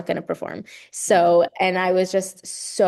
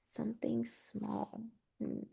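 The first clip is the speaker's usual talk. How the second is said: whispering; 4 semitones higher, 17 dB quieter, slower than usual. Real, not acted. Musing to herself on question.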